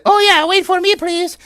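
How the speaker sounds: very high, pleading fairy; high little fairy plea. Acted. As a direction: squeak it like a fairy left behind. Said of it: "And the fairy said".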